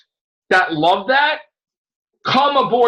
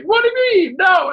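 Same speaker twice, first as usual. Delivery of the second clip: incredulous protest; 10 semitones higher than usual. Retold or imitated. Reacting to the film in the theater, high incredulous protest. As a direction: protest in disbelief.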